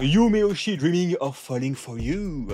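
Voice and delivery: slow, self-mocking English accent on a manga title. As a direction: read the title slowly in a hammed-up English accent. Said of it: "avec mon magnifique accent anglais". (accent anglais exagéré et lent, pour rire)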